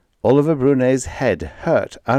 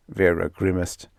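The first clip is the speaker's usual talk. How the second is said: ordinary delivery, nothing marked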